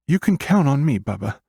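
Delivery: put-on high, small child's voice; little sister. A narrator's reading. Little sister answers brother.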